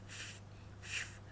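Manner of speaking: F as bare air, no vowel; Chinese f sound. Shown as a demonstration. Teacher repeats the fourth initial, only air. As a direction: blow out a soft "f". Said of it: "That's how you pronounce this".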